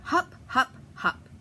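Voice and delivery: ordinary delivery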